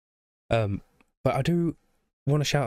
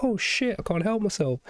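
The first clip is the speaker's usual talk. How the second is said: excited; 9 semitones higher than usual. Retold or imitated. Viewer's imagined reaction.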